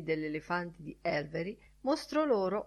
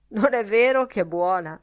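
raised, louder appeal for agreement; insistent; 4 semitones higher, 7 dB louder than usual. A narrator's reading. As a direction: press the listeners to agree. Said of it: "insistette mister Bloom".

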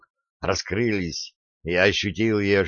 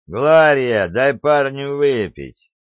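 loud, slow, higher call across the room; 5 semitones higher, 5 dB louder, slower than usual. A narrator's reading. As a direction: call out loudly and slowly. Dix loudly calling to Gloria.

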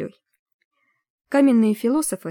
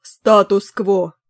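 slow loud roar; roaring chorus; 6 dB louder, much slower than usual. A narrator's reading. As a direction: roar it together like a tribe. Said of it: "дружно проревели".

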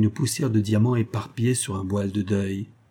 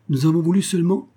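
trembling voice; 7 semitones higher, 3 dB louder, faster than usual. Acted. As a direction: voice trembling with emotion. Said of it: "et la voix de Jacques trembla".

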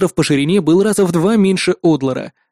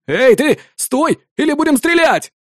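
shout; 10 semitones higher than usual. A narrator's reading. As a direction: yell a warning, voice rising high. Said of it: "крикнул какой-то парнишка-лучник". (крик молодого лучника)

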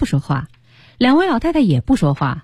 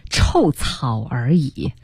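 slow, low, scornful sneer; sharp and cutting; 6 semitones lower, much slower than usual. Acted. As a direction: spit it out with contempt. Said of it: "很尖锐，很刻薄地说".

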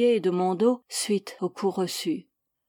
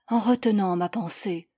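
ordinary delivery, nothing marked

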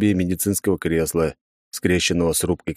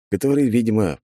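plain reading voice

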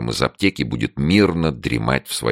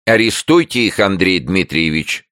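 high, loud, fussy plea; jabbering neighbour; 7 semitones higher, 4 dB louder than usual. A narrator's reading. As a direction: urge the arrest from behind the door. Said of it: "затараторили за дверью". (суетливая соседка, высоко и громко)

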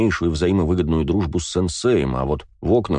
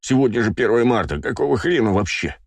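higher, outraged about the frost; indignant character voice; 5 semitones higher than usual. Acted. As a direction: grumble at the cold in disbelief. Begemot complains about the cold.

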